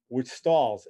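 usual speaking voice

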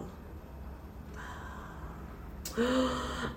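long emotional breath out. Real, not acted. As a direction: sigh as it sinks in. After reading the verse.

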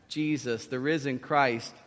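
normal talking voice

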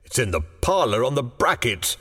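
put-on puzzled old man, pitch lifted; bemused husband; marvelling. A narrator's reading. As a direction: answer in mild wonder.

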